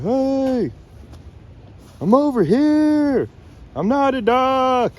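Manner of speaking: high, slow call across the water. Retold or imitated. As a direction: call out so the hunters know you're no duck. Calling out to duck hunters.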